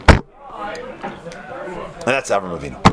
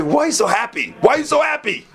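high-pitched demand, climbing higher on the repeat; mock exasperation; 10 semitones higher than usual. Wife baffled by her cheerful husband, exasperated. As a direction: build from puzzled to squawking disbelief.

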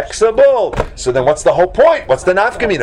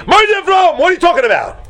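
excited outburst; 11 semitones higher, faster than usual. Acted. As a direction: protest like a startled rabbi. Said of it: "getting all excited".

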